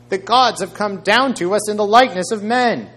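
high, loud proclamation; lifted voice. A narrator's reading. Crowd acclaiming Paul and Barnabas as gods, voice lifted high. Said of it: "they lifted up their voices".

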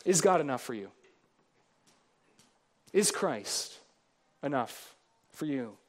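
low and soft, searching question; quiet appeal. Real, not acted. Preacher quietly asking his church, hushed. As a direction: draw them in gently.

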